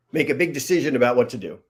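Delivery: ordinary delivery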